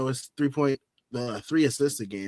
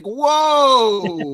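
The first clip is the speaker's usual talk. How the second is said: surprised whoa; 10 semitones higher, 6 dB louder than usual. Retold or imitated. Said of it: "It's like".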